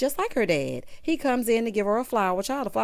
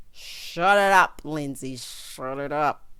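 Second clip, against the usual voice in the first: mock scolding; 6 semitones lower, slower than usual. Host hushing bride during vows, mock scolding. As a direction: stretch each command in comic exasperation.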